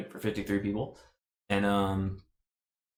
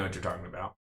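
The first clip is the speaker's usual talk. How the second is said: deep voice; 3 semitones lower, 6 dB quieter, faster than usual. Acted. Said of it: "that is a deep voice".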